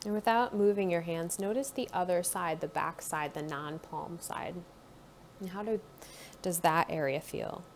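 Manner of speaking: calm guided body-scan voice; guided meditation. Acted. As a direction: ease the listener inward, gently.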